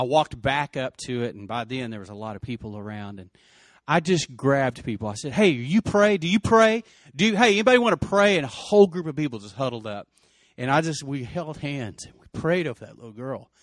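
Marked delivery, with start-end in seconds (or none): 5.3-8.4 loud, high appeal to a crowd; urgent calling out. Retold or imitated. Retelling how he grabbed people at the wreck, urgent loud call to pray. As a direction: raise pitch and volume, rallying bystanders. Calling out to gathered crash bystanders.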